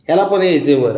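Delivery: ordinary talk, nothing marked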